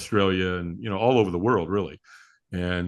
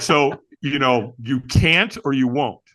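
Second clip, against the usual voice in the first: slow, louder, pressing question; pointed question; 2 semitones higher, 4 dB louder, slower than usual. Retold or imitated. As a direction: lean on them slowly, like a challenge. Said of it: "And they're like".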